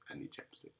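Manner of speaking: soft whispered aside; whispering. Real, not acted. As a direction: whisper it under your breath.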